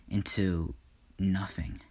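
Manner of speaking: usual speaking voice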